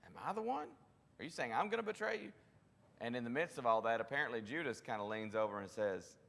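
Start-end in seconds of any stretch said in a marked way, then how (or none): 0.0-2.3 high, quick worried questions; a disciple's alarmed, fast, high-pitched plea; anxious disciples. Retold or imitated. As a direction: pepper him with nervous questions, up high. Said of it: "bombardment of questions".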